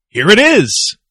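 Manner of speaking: loud, high-swooping presenter's call; showy announcement. Pretend. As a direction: belt it out to launch the clip. Launching the interview clip.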